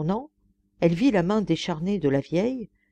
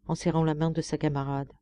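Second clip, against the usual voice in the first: usual speaking voice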